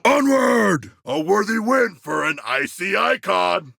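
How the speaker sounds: rally the squad forward with a yell; rallying cry. Acted.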